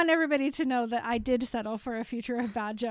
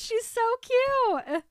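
gushing; 9 semitones higher, 5 dB louder, much slower than usual. Real, not acted. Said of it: "It's a really cute Bella Kitchen dance".

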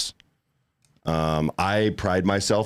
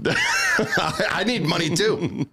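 laughing; 9 semitones higher, much slower than usual. Pretend. Mock begging, laughing.

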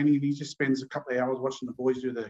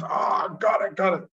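higher, eager got it; light up at the answer; mild mock excitement; excited; 6 semitones higher, slower than usual. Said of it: "Teddy gets all excited".

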